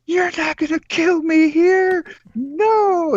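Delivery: high, loud panicked scream, slowed; panicked shriek. Retold or imitated. Girlfriend shrieking at him in panic in the dark. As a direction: shriek it like she fears for her life.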